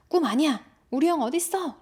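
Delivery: high, loud, insistent cry; shouting. Acted. Boy yelling at the brother outside, shouting. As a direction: shout back, refusing to believe him. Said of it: "라고 막 소리칩니다".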